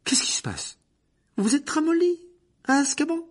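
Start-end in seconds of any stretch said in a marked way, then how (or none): 1.4-3.2 high, loud taunting cry; much higher and louder jeer; Harry's furious taunt. Acted. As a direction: fling the insult at him.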